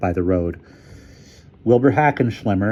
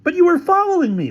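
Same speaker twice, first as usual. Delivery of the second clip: high, loud protest; high-pitched quarrelling voice; outraged accusation; 5 dB louder than usual. Acted. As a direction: fire back indignantly. Quarrel, Featherstone accuses Hamilton.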